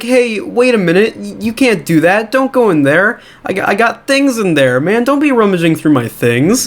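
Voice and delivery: high, mock-anxious protest. T L O to the principal reaching for her purse, protesting.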